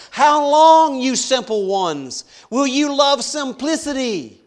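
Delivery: high, loud cry of How long. Acted. Wisdom crying out to naive people, loud high plea. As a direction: call out to the simple ones. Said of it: "She raises her voice".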